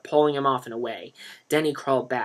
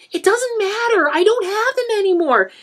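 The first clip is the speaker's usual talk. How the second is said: much higher, louder crying; 9 dB louder than usual. A narrator's reading. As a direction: sob it out. Denny crying.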